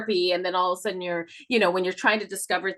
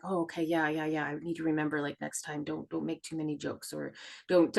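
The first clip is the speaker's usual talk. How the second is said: resigned inner voice; 4 semitones lower, 7 dB quieter than usual. Retold or imitated. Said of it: "I'd be like".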